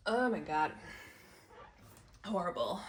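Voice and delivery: groaning through a sudden hot flash; overheated, exasperated groans. Real, not acted. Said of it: "hot flash coming".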